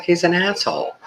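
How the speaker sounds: high-pitched mimicry of the wife; imitating wife. Wife judging the surgeon, high-pitched imitation. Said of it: "She's like".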